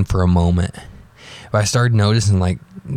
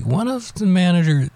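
first take pitched well up, then dropped; high start; 11 semitones higher than usual. Real, not acted. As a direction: begin the phrase high and set it aside. Restarts sentence, first take high.

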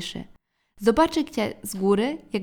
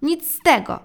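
firm refusal; 6 semitones higher, 7 dB louder than usual. A narrator's reading. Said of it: "powiedział tata".

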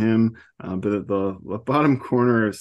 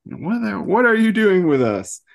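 high, louder angry yell; hammed-up furious demand; teen yelling at scientists; mock yell; 12 semitones higher, 5 dB louder than usual. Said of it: "starts yelling at everybody".

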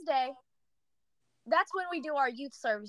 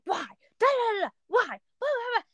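higher, sing-song whine of a complainer; mock whiny complaining; 9 semitones higher than usual. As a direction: whine it like a moody teen, playfully. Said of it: "I've been super whiny".

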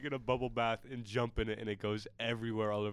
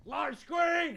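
loud, high-pitched shouted order; imitated kitchen yell; 7 dB louder than usual. Coworker imitating louder kitchen callers across the entire thing, yelling. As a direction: holler it across the whole kitchen. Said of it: "you have to yell certain things".

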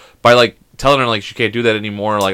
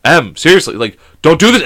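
mild alarm; 4 semitones higher, 6 dB louder than usual. Retold or imitated. Retold worry as daughter plays dead.

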